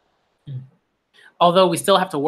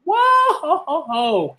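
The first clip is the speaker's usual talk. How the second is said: whoa shout; 10 semitones higher, slower than usual. Real, not acted. Reader startled by an absurd character, high shouted whoa. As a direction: recoil at how over the top she is. Reacting to an over-the-top character.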